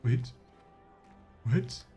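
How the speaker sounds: ordinary talk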